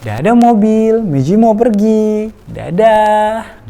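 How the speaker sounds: high, loud, very slow bye-bye to the car; sing-song goodbye. Shown as a demonstration. Parent saying goodbye to the toy car, high slow sing-song. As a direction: sing it out gently to a toddler. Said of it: "Komunikasi kita cukup di".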